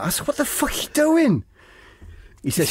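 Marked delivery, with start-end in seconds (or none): 0.3-1.4 high-pitched outraged protest; acted shocked outburst; indignant mock yell. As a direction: blurt it as a high, hammed-up yelp. Retold panicked protest to attacker.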